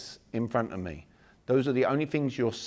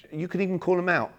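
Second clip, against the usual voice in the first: usual speaking voice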